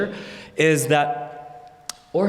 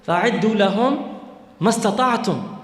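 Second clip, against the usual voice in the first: Quran quotation; 5 semitones higher, much faster than usual. Shown as a demonstration. Quotes Quran verse.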